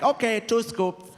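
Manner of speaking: high-pitched imitation of mom; lightly caricatured mother offering food; mom impression. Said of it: "She's like".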